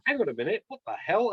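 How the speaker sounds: high, loud startled disbelief; retold confused outburst; baffled double take. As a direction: react like you can't follow what you just saw. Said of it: "but I was like".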